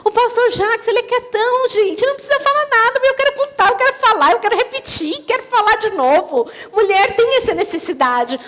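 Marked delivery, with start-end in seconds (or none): 1.7-6.5 higher, pressing, eager chatter; eager to talk. Retold or imitated. Wife imitating her need to talk to her husband, higher and eager. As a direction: burst with the need to tell everything. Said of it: "porque mulher quer falar".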